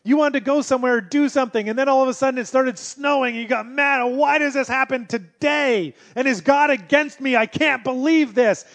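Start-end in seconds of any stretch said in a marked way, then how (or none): 4.0-8.6 frustrated rant. Retold or imitated. Voicing frustrated person, snow.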